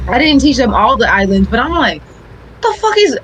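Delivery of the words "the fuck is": exasperated outburst. Retold or imitated. Retold exasperation.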